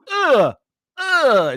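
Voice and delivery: loud, high-pitched mock groan of disgust. Reacting to Nicolas Cage's Southern accent in Con Air, disgusted groan. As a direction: let out a pained, exasperated "ugh". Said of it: "every time he talks, I'm like".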